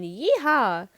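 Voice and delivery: high, loud cowboy whoop; party yell. A narrator's reading. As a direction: let out a rowdy holler. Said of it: "someone in his living room yelled".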